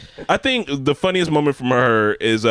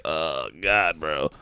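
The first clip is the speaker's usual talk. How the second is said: much lower, softer groan; mock dismayed mutter; 8 semitones lower, 8 dB quieter, much slower than usual. Reacting to the grandpa dying, low dismayed groan. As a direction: sink the voice in disbelief. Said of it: "it was like".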